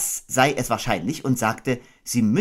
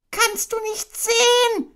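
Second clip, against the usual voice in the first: very high, squeaky indignant call; crying out; 4 dB louder, slower than usual. Acted. Piglet crying out at Kanga to look at him. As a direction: yell as a frantic tiny pig. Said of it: "rief Ferkel".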